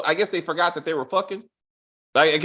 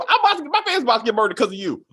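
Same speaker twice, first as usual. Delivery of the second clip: fast rant; 6 semitones higher, 4 dB louder, much faster than usual. Real, not acted. Ranting at the character.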